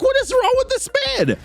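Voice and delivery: high-pitched mock disbelief; incredulous. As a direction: exclaim it way up high, baffled. Narrator's own baffled reaction, retold.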